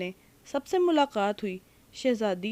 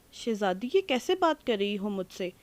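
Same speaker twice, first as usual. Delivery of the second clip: plain reading voice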